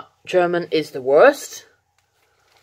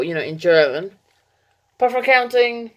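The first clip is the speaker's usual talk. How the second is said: normal talking voice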